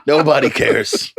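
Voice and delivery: laughing it off, high squeak. Real, not acted. Social guy laughing off online jokes about him. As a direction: laugh through the words.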